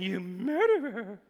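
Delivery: slow, drawn-out mocking quote; mimicking an accuser, dragged out; mock accusation. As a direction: stretch out murderer in mock disbelief. Mocking repeat of Jezebel's accusation.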